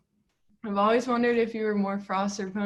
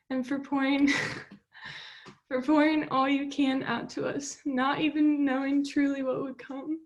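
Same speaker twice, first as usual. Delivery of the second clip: higher, holding back tears; fighting tears through the thanks; voice breaking; 5 semitones higher than usual. Real, not acted. Tearful thank-you speech.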